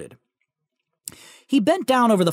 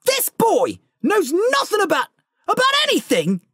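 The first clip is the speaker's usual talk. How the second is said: growled anger; 13 semitones higher than usual. Acted. Giant growling at the Dursleys in outrage. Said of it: "he growled".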